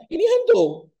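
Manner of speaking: high, loud scared cry of ghost; panicked yelp at a ghost; frightened shriek. Acted. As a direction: shriek it like terrified disciples. Disciples shouting it's a ghost.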